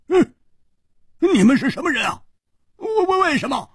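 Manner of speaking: high-pitched, startled stammering question; loud demand. A narrator's reading. Hu Tiehua questions two strange attackers, loud demand. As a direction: ask it high and insistently, tripping over the words. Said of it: "他忍不住大声地问".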